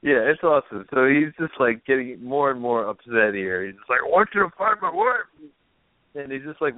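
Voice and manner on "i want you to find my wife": desperate husband quote. Retold or imitated. Retelling desperate husband's plea.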